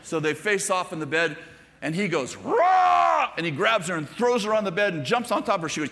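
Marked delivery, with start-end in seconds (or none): 2.4-3.3 high, loud mock roar; play roar. Grandson pouncing on his sister in a wrestling game, mock roar. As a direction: let out a long childlike beast roar.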